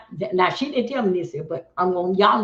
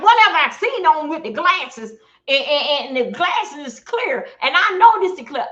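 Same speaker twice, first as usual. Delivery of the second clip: much higher and louder disbelief; retold disbelief; 7 semitones higher, 5 dB louder than usual. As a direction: sound baffled, high and loud. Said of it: "And I'm like".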